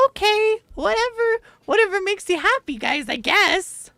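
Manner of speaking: high, dismissive sing-song; mock dismissive. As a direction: brush it off in a high voice. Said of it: "And I'm just like".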